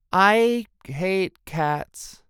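high, drawn-out, forced words; strained denial. A narrator's reading. Lulu lying about the kitten while shaking, strained denial. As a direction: force out each word against your own urge. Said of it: "visibly shaking".

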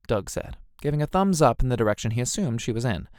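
usual speaking voice

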